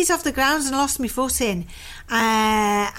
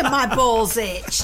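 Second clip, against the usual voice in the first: slow drawl; much slower than usual. Pretend. Slow repeat.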